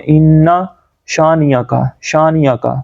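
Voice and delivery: "شانیہ کا" glided through with a y; hamza skipped. Shown as a demonstration. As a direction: slur "شانیہ کا" into one quick word. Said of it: "یہ جو غلطی ہے نا".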